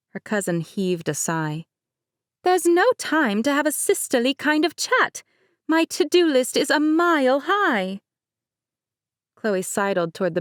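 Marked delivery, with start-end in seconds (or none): 2.4-8.0 light higher-pitched bride, laughing it off; Mercedes voice. Acted. Bride Mercedes teasing her cousin, higher light voice. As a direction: play the bride breezily.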